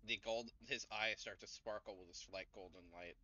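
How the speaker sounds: ordinary talk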